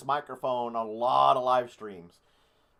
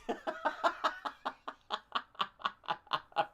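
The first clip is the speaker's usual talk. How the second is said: high wheezing laugh; real helpless giggling fit; long laugh; 11 semitones higher, 4 dB quieter than usual. Real, not acted. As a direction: laugh until the breath runs out. Laughing at communist mics comment.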